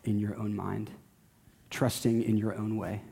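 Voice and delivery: ordinary talk, nothing marked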